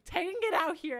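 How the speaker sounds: a retold dad shouting her name; dad calling out. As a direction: summon her loudly from the door.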